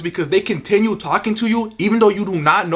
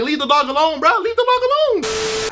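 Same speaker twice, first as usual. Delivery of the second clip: high whiny plea; 9 semitones higher than usual. Retold or imitated. Defending the dog from the kids, high pleading whine.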